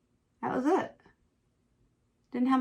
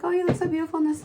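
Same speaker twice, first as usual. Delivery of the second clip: high, fast, sugary compliment; mock sweet mother-in-law; 8 semitones higher, faster than usual. Imitating the mother-in-law's fake compliment at the wedding, high and sugary. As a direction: gush it falsely. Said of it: "She's like".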